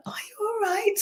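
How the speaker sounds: very high, soft caring question; caregiver voice. Retold or imitated. Coach imitating a caregiver checking on someone. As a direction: ask it sweetly like a worried carer. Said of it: "the little girl voice leaks out".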